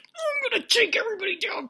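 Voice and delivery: high, strained whispery monster growl; Hulk mode. Retold or imitated. Tammy attacking everyone with a pillow, Hulk mode. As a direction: rasp it out as a rampaging brute. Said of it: "Tammy's going Hulk mode".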